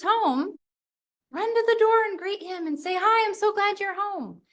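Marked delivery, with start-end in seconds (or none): 3.0-4.4 bright, high happy welcome; excited greeting. Shown as a demonstration. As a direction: greet him like you missed him. Greeting husband warmly at door.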